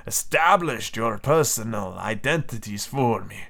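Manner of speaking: a commander's badly faked European accent; wandering foreign accent. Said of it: "this accent isn't French".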